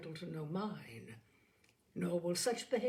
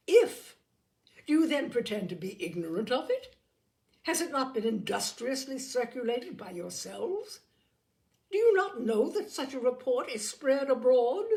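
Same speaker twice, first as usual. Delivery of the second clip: shrill high outburst, then loud demands; outraged shriek; 4 semitones higher, 8 dB louder than usual. A narrator's reading. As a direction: explode with indignation. Lady Catherine outraged at Elizabeth.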